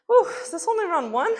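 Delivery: high winded gasp; puffing between reps; out of breath. Real, not acted. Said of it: "This cardio is getting me".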